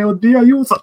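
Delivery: high, loud panicked cry before sneezing; about to sneeze. Real, not acted. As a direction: yelp as the sneeze hits. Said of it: "sto per starnutire".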